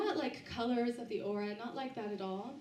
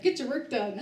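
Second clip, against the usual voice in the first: gruff; 9 dB louder than usual. Retold or imitated. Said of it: "No, they were like".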